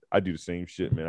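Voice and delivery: ordinary talk, nothing marked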